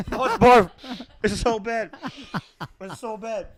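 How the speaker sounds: laughing in disgust. Real, not acted. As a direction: laugh through the gross taste. Tastes barf-flavoured bean.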